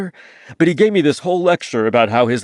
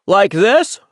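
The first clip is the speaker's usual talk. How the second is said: put-on thick New England accent, pitched up and loud; 7 semitones higher, 6 dB louder than usual. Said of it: "terrible imitation of a thick New England accent".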